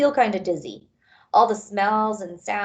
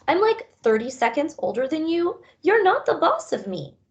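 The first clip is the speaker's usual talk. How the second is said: put-on higher, scoffing kid sister voice; sassy sister; 8 semitones higher than usual. Sister dog scoffing at her big brother, higher and sassy. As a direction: toss it off like a bratty sibling.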